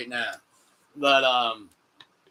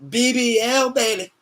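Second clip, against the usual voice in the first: higher and louder, drawn-out hype; hype announcer; 5 semitones higher, 5 dB louder, slower than usual. Pretend. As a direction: announce it like a ring caller. Hyping the match like an announcer.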